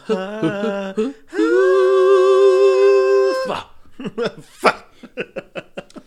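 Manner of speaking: high shrieking cackle; loud howling laugh. Real, not acted. Big laugh after ad.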